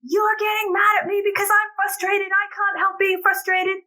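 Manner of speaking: put-on high, whiny kid voice quoting her son; mimicking a whining child; imitating upset child. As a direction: play her frustrated ten-year-old, pleading. Son quoted arguing with mother.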